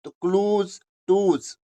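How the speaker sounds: normal talking voice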